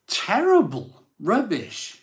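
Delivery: high, loud scornful verdict; disgusted outburst. Retold or imitated. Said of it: "I was like".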